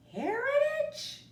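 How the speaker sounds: put-on small boy puzzled by a big word, slow and high; wondering. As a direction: puzzle over the strange new word. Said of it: "Moncho wondered".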